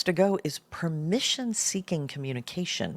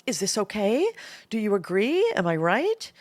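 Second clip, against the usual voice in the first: higher questioning rise ending each phrase; uptalk seeking approval; 4 semitones higher than usual. Acted. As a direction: lift "okay", "agree" and "right" as if asking permission. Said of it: "turns it into a request for approval".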